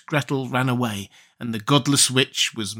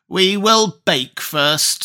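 witch announcing; 6 semitones higher, much slower than usual. Acted. Witch speaks.

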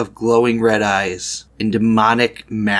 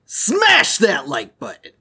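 YouTuber outro; 5 semitones higher than usual. Pretend.

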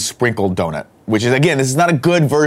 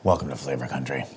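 low announcer voice; 10 semitones lower, 11 dB quieter than usual. Acted.

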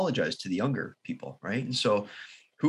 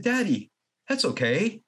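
put-on higher, slow, gentle little boy; 7 semitones higher, slower than usual. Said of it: "He looked at me, he said".

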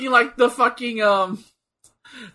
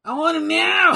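shouted quote; 9 semitones higher than usual. Retold or imitated. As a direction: yell it like a wrestler. Quoting wrestler's shout.

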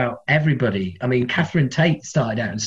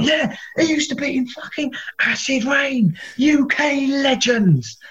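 much higher, slowing on the legend bit; fan enthusing; 11 semitones higher, slower than usual. Retold or imitated. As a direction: sell the legend to her. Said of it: "he's going".

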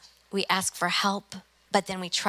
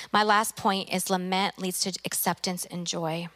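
normal talking voice

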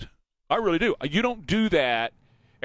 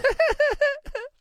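high laugh. Real, not acted. Laugh after mockery.

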